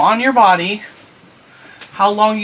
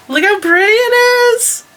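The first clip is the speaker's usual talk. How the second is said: high delighted voice. Real, not acted. Admiring the finished cardigan.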